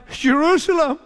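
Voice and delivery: high-pitched, emphatic doubled cry; retold cry. Preacher voicing Jesus crying over the city, emphatic high cry. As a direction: cry the name out twice, pitched well up. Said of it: "When Jesus cried".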